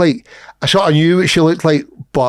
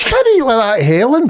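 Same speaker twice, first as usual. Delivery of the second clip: scolding disbelief; 7 semitones higher than usual. Retold or imitated. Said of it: "I says".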